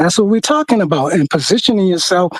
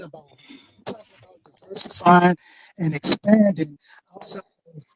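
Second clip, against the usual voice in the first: choppy cutting-out call audio; breaking up connection; 6 dB quieter than usual. Real, not acted. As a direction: voice dropping out on a bad line. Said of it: "You're breaking in and out really bad".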